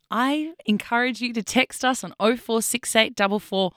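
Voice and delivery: usual speaking voice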